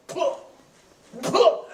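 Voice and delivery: high puffed spitting noises; mock spitting. Sick friend spitting out the car window, mock spitting. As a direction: spit it out the window, imitated. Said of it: "and he's going".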